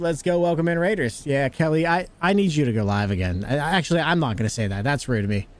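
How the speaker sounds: ordinary talk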